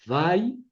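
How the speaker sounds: Vay alone, drawn out and rising; isolated syllable. Shown as a demonstration. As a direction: model Vay as one closed syllable, pitch climbing. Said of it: "La primera sílaba es Vay".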